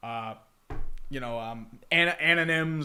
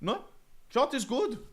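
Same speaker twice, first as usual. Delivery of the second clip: mock French accent; 8 semitones higher than usual. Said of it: "that's how we say biking in French".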